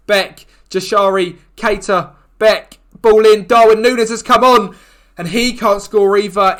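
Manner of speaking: rising play-by-play yell as the ball comes in; match getting tense, building to a shout; excited commentary. Real, not acted. Attack building toward goal.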